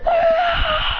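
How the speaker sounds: very high, sustained yell; high anime power-up scream; acted power scream. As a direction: strain out a long battle scream. Hero screams ripping the planet.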